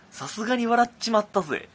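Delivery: high, gruff gangster read; tough-guy letter voice. Retold or imitated. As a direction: read it like a cocky thug. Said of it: "こんな口調の脅迫状". (悪役っぽい口調で)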